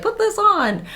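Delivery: high, offhand mock voice; mock costume guy. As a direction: toss off the line like a bored costume guy. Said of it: "and was like".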